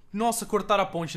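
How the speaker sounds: ordinary talk, nothing marked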